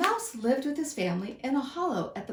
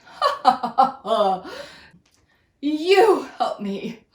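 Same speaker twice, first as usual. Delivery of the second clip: loud, mocking laughter; scornful lion laugh; 5 semitones higher, 9 dB louder, slower than usual. Lion mocks the mouse's offer, loud scornful laughing. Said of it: "he said scornfully".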